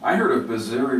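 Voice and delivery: normal talking voice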